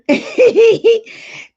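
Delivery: bursts into high, loud laughter. Real, not acted. Thanked by a fan, she laughs out high and loud. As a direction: cackle with delight at the compliment. Laughs after reading compliment.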